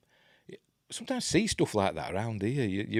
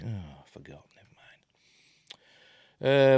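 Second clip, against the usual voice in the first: slow, drawn-out whisper to yourself; whispered aside; 6 dB louder, much slower than usual. Real, not acted. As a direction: breathe it out as a private whisper. Whispered aside after forgetting drink.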